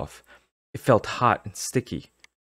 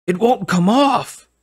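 panicked cry; 13 semitones higher, 6 dB louder, slower than usual. Acted. Panic, mask stuck.

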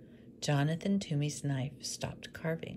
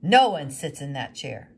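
much louder, firm; angry woodcarver; 12 dB louder than usual. Acted. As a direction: forbid it flatly. Woodcarver forbidding the chair.

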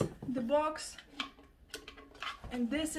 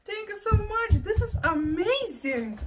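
excited thanks; 6 semitones higher, 7 dB louder than usual. Real, not acted. Thanking the gift sender.

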